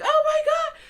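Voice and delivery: very high mock-panicked squeal; exaggerated high-pitched dismay; retold freak-out. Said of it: "I'm, like, freaking out".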